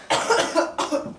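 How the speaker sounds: a sharp, raspy cough; real cough. Real, not acted. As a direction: cough hard, struggling for air. Choking on sprayed pop.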